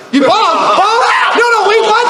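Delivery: high, loud startled yelps of disbelief; alarmed shout. Retold or imitated. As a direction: cry out like a shocked paramedic. Alarmed responder hears "bombed.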